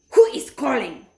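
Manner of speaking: a sharp witch demanding an answer; Grand High Witch demanding who calls. A narrator's reading. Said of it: "said the Grand High Witch".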